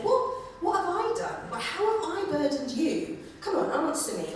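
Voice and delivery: high, exasperated God asking what he has done; pleading challenge. Retold or imitated. God confronting Israel in court, exasperated and high. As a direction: press Israel like a wronged party. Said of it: "he starts by saying".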